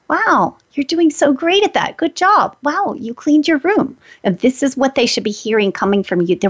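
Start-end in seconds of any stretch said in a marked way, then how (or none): 0.1-4.0 put-on higher, slow, gushing praise for a child; proud parent cheering a child on; enthusiastic praise. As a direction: lay the praise on warmly and brightly. Praising kids as example.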